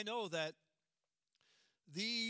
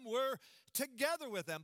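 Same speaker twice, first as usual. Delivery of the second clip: together leaned on, falling firmly; stress on together; 3 semitones higher than usual. Shown as a demonstration. Preacher stressing unity with the dead, emphatic together. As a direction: hit together hard. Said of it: "there's an emphasis".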